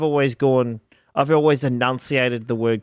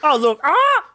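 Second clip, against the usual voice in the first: high, loud squawky mock cry; squawking seagull; 13 semitones higher, 5 dB louder than usual. Continuing the seagull joke, high squawk. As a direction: squawk "ah" like a bird.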